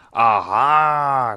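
troll's loud, drawn-out war cry; loud roar. A narrator's reading. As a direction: roar it out to threaten the rider. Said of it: "громко взревела она". (громкий рёв тролля)

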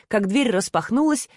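usual speaking voice

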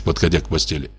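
ordinary delivery, nothing marked

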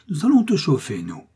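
high, loud, mocking menace; sarcastic threat. A narrator's reading. Chief threatening to burn the woman, horrible sarcasm. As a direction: taunt with sinister relish. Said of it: "cet horrible sarcasme".

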